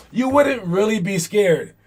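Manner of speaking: ordinary talk, nothing marked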